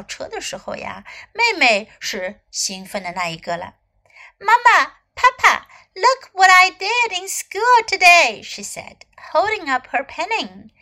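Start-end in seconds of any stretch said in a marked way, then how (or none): 4.4-8.4 high, loud and eager; excited child calling. A narrator's reading. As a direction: show it off proudly to mom and dad. Excited child calls her parents. (兴奋地高声喊爸爸妈妈)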